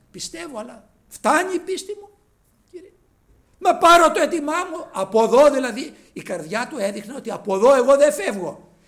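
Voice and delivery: loud, high desperate plea; pleading father. Acted. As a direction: beg the Lord not to leave. (ικετευτικά, δυνατά)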